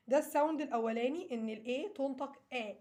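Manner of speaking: ordinary talk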